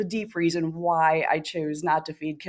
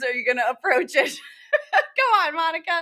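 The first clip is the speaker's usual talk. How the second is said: can barely get the words out for laughing; 10 semitones higher than usual. Real, not acted. Cohost teases the host about the van while laughing. Teasing friend, cracking up.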